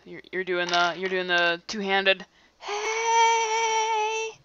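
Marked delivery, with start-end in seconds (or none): 2.6-4.3 whisper yelling. Acted. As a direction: call out but keep it hushed. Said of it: "Kinda like, kinda like whisper yelling".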